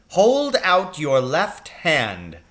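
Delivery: slow, high, cold command; stern captain. A narrator's reading. Captain coldly orders the boy to hold out his hand, slow. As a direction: spell out each word, slow and cold.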